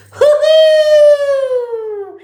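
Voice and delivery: very high, drawn-out whoop; gleeful cheer. Pretend. Streamer celebrating a break from the singing, gleeful cheer. Cheers at singer being silenced.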